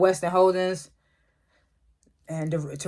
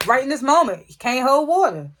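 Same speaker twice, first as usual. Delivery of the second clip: higher, louder emphatic complaint; exasperated; 6 semitones higher, 5 dB louder than usual. Real, not acted. Vlogger complaining Braden snitched, loud and emphatic. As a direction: stress it, fed up with him.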